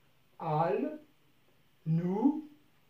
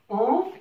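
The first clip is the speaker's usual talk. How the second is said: "En" lifted higher and louder on its own; isolated syllable stress; 6 semitones higher, 5 dB louder than usual. Shown as a demonstration. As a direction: punch the syllable "En". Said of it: "نلاحظ كلمة enfant".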